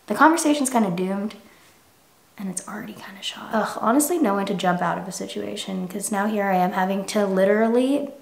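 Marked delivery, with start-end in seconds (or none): 2.4-3.5 whispered aside. Real, not acted. Muttered aside about doomed conversation.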